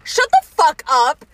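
loud and slow in disbelief; stunned shout at gossip; shocked. Real, not acted. Reacts to pregnancy news.

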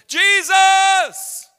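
huge high shout of the name; ear-splitting shout. Acted. Blind beggar shouts at passing Jesus, full-force cry. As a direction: bellow it out like a desperate beggar. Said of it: "Sorry if I hurt your ears right there. I get a little excited".

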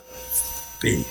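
low, weighty and drawn out; deep, slow single word; big-faith voice. Shown as a demonstration. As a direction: drop it low to sound big, against a tiny squeak. Big faith against little bitty faith.

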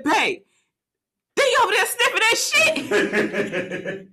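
high, slow, laughing alarm to husband; urgent alarm. Retold or imitated. Wife telling husband about the sniffing driver, high urgent. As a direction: stretch it out, shocked and half laughing. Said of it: "the way she said it".

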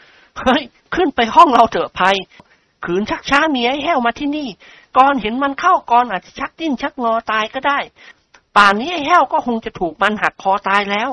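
a bright jokey friend urging Prapai upstairs; Nikorn's voice. A narrator's reading. (เสียงนิกรชวนประไพขึ้นห้อง)

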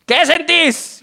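escalated insistent question. Acted. Joke doctor asking his patient mid-exam, high and demanding.

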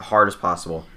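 ordinary talk, nothing marked

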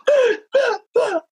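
high, hammed-up sharp gasp; mock shocked gasp. Wife told to hang up, gasping in mock shock. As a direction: gasp like she can't believe it.

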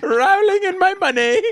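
high, laughing put-on British accent; a giggly British accent while mocking. Joking about the author, laughing British accent. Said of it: "I just want to do the accent too".

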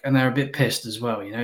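usual speaking voice

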